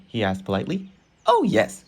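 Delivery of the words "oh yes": a much higher, squeaky "Oh, yes"; eager yes. Acted. Train cheerfully agreeing to the polite gang member's request, squeaky. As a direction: chirp it out, far above the narration.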